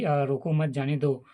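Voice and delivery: ordinary delivery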